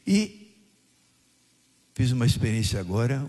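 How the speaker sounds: usual speaking voice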